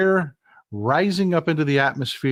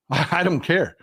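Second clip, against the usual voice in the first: said through a real laugh, a little slower; chuckling, dismissive. Real, not acted. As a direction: laugh it off as you repeat it. Repeats it, laughing.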